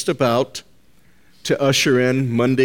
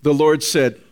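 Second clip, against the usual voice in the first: ordinary talk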